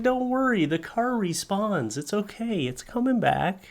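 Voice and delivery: high, soothing, reassuring tone; retold reassurance. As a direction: comfort her like a worried child. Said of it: "I was like".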